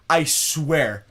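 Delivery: usual speaking voice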